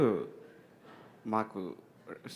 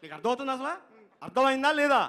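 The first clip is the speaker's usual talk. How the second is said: high demand rising in volume; exasperated director; 12 semitones higher, 6 dB louder than usual. Retold or imitated. Director asks assistant if he understands at all, exasperated. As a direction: mimic a boss losing patience.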